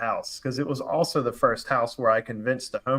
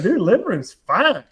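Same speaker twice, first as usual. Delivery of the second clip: upbeat homeowner; 8 semitones higher, 5 dB louder than usual. Retold or imitated. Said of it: "He's like".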